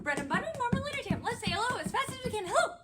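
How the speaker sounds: high, loud, racing chant; super fast. Shown as a demonstration. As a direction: rattle it off at double speed. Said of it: "can say it faster".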